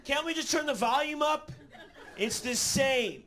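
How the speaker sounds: high-pitched mock complaint; hammed-up, high protest; whining roommate. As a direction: whine like a put-out roommate. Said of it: "He's like".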